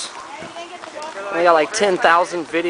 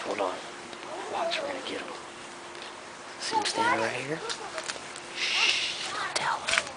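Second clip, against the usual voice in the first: high, hushed whisper; 13 semitones higher, 15 dB quieter than usual. Real, not acted. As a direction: whisper like you're sneaking up on someone. Sneaking up to scare people.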